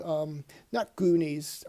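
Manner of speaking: usual speaking voice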